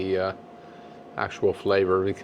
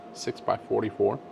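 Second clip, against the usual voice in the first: normal talking voice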